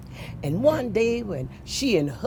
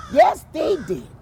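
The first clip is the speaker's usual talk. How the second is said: exclaiming; 8 semitones higher, 7 dB louder than usual. A narrator's reading. Teller exclaims about triplets.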